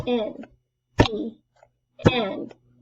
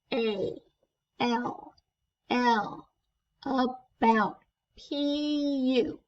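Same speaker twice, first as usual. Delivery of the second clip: pinched nose; spelling with nose closed; nose held; 4 dB quieter, slower than usual. Shown as a demonstration. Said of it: "with your nose closed".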